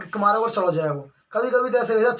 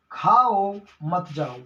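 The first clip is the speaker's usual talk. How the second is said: slow खाओ, break, then मत जाओ; pause after खाओ; 3 semitones lower, much slower than usual. Shown as a demonstration. Teacher repeating the example for students, pause after खाओ. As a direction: set खाओ apart, run मत जाओ together. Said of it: "जैसे".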